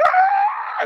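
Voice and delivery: sharp high yelp of shock; sudden yell. Retold or imitated. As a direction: let out a sudden loud cry. Sudden outburst under pressure.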